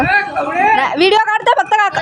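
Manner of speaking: telling the shouting farmer she's only filming; calling out to uncle. Real, not acted. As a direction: reassure the farmer from a distance.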